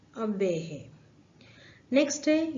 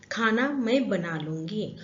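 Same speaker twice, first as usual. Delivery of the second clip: normal talking voice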